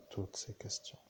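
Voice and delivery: breathy whisper, trailing away; whispered aside. Real, not acted. Pondering life's questions for listeners, whispered. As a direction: let it drop to a whisper, pondering.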